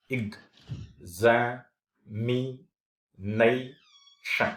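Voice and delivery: "examination" very slowly, a long pause after each syllable; one puff of air per syllable of "examination". Shown as a demonstration. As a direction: chop "examination" into separate, drawn-out syllables. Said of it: "a single puff of air".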